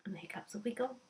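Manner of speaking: a soft, breathy whisper. Shown as a demonstration. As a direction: whisper it gently. Soft closing of the demo.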